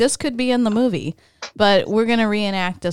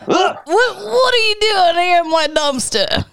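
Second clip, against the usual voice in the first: high, indignant raccoon voice; indignant raccoon character; 9 semitones higher than usual. Acted. Billy the raccoon confronts cats in his dumpster, indignant high voice. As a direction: play a territorial raccoon, pitched right up.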